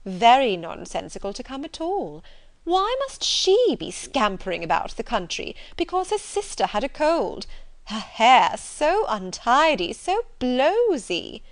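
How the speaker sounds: high, swooping disdain with drawn-out words; scornful society lady. Acted. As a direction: sneer theatrically at her untidy rival. Reader voices scornful sister.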